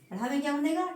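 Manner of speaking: usual speaking voice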